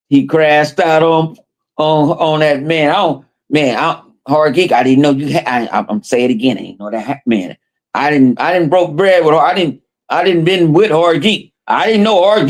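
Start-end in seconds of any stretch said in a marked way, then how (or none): none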